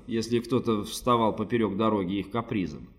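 usual speaking voice

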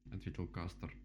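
usual speaking voice

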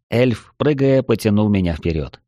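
ordinary delivery

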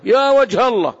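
sudden high, loud astonished cry in colloquial Arabic; صيحة تعجب بالعامية. Retold or imitated. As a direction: mimic a startled commoner blurting it out in colloquial Arabic. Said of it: "أنا أنطقها بالعامية لأن البعض من الناس هكذا ينطقها".

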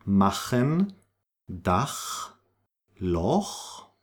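machen, Dach, Loch with a throat rasp; spaced words, scraped ch as in Scottish Loch; throaty ch. Shown as a demonstration. Teacher gives examples of CH, back-of-throat sound. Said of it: "that's the case in".

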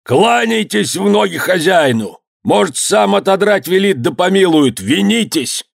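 high, urgent pleading cry; shouting. A narrator's reading. Nadeika urging workers to beg mercy, high urgent shout. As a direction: exhort them in a high, stretched voice. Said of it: "крикнул Надейка".

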